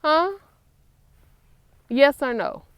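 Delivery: high, loud "Huh?"; playful demand. Real, not acted. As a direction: press him for an answer. Pressing son for answer.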